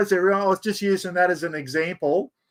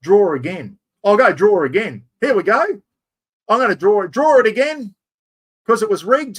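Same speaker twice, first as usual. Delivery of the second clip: loud, eager announcing of a redraw; excited; 7 dB louder than usual. Real, not acted. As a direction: call it out big for the viewers. Excited draw announcement live.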